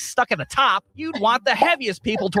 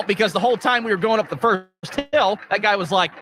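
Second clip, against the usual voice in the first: normal talking voice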